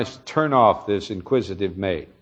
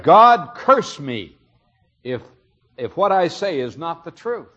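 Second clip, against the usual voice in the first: vehement oath; 7 semitones higher, 4 dB louder than usual. Retold or imitated. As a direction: swear it hard to be believed. Peter swears a curse on himself.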